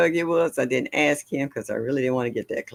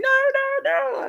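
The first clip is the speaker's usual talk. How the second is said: high squealing "no, no, no" like a frightened man; mock scared squeal. Imitating her big cousin panicking at a bug, high squeal.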